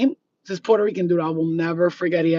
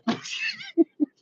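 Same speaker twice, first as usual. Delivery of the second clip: a sudden squeaky high cackle; real burst of high laughter; 5 dB quieter than usual. Real, not acted. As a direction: let out a shrill-pitched giggle. Cackling at own joke.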